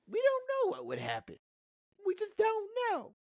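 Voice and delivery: a very high, put-on squeaky voice; hammed-up high voice; mock dramatic voice.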